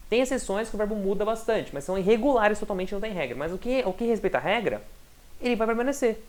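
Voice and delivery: ordinary delivery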